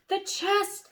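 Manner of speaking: loud, high cry of discovery; excited shout. A narrator's reading. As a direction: call it out together in delight. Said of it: "they all shouted".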